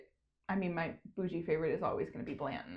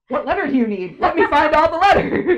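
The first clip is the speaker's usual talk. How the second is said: excited mom; 8 semitones higher, 16 dB louder than usual. Retold or imitated. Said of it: "She was like".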